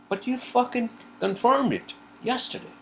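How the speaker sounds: exasperated outburst. Real, not acted. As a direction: fire it off in outrage. Venting at the airline.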